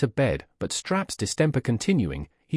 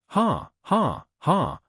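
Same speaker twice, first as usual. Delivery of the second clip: mocking laugh; 7 semitones higher, much slower than usual. A narrator's reading. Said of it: "cried Miss Jenny".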